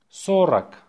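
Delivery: brisk dictation repeat. Shown as a demonstration. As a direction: say the word short and quick.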